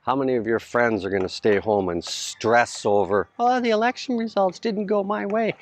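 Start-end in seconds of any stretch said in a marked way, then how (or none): 3.4-5.5 high, far-off whiny voice mocking a fretting friend; a friend moaning that the election went the wrong way, in a high mocking whine. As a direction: whine it out like someone sulking over the vote.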